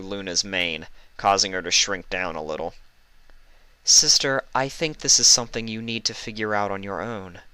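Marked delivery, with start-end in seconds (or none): 3.8-7.4 fond. Acted. Celestia fond teasing.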